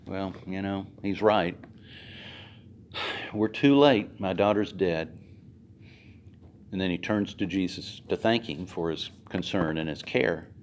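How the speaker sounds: soft, low resigned voice; defeated father. Acted. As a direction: let it drop, hope gone.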